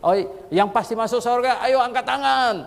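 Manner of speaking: high, loud rallying call. Shown as a demonstration. Preacher calling the congregation to raise their hands, loud. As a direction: summon the hall to raise hands.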